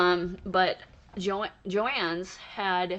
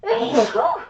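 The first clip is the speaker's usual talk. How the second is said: a sudden loud sneeze; real sneeze, then a word; sneezing; 8 semitones higher, 9 dB louder than usual. Real, not acted. Sneezes on camera.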